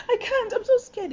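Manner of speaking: very high scared plea with a laugh; half laugh, half panic. Retold or imitated. Learner driver begging the evaluator, very high and laughing. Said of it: "And I'm like".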